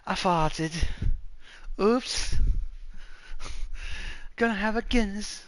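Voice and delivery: high, put-on Irish accent. Shown as a demonstration. Man demonstrating Irish over a Guinness, Irish accent. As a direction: play it as high mock-Irish. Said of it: "Irish is".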